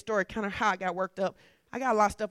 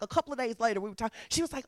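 usual speaking voice

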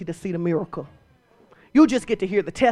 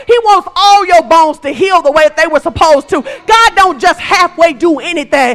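much higher and louder, hammering each line; preaching at full force; 7 semitones higher, 11 dB louder than usual. Real, not acted. Preacher telling the church God heals fully, much louder and higher. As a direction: preach it hard, whole-hearted.

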